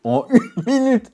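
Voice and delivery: mock the promise in disbelief. Scoffing at the video's one-minute sharpening promise, mocking.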